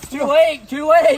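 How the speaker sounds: high, loud taunting; high loud singsong taunt; gleeful taunt. Acted. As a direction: crow it at him. Taunting as monkey attacks.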